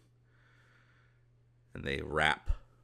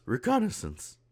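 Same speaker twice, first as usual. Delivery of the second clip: much higher-pitched character voice; Sage; 12 semitones higher than usual. Acted. Game master as Sage announcing the task, high-pitched. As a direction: pitch the single word way up in character. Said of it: "As Sage goes".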